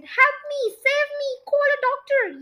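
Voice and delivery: high panicked cries for help; yelled for help. A narrator's reading. Husband yelling for help as he shrinks, high panicked cry. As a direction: beg desperately for a doctor. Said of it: "yelled Mister Twit".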